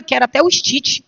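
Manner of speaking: usual speaking voice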